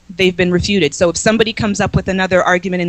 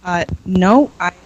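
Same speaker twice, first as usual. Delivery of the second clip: ordinary delivery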